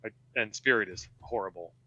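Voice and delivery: usual speaking voice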